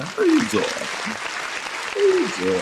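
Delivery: way up high, the second stretched slow and breathy; high squeaky greeting. Pretend. Host greeting Veronica in a squeaky falsetto, playful.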